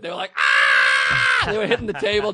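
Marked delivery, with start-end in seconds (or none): 0.4-1.4 whoop. Retold or imitated. Women hitting table laughing.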